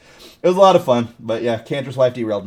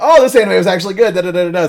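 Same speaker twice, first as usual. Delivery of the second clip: much higher, louder, faster mocking imitation; mock friend voice; 9 semitones higher, 10 dB louder, faster than usual. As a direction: ham up the friend's fake praise. Imitating friend's anime verdicts.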